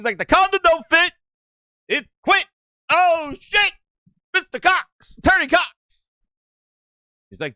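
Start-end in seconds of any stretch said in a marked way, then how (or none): 0.2-6.1 high-pitched, excited mock outburst; hammed-up lawyer shout. Mister Cox makes his case for Booty, hammed-up lawyer shout. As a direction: shout it like a showboating attorney.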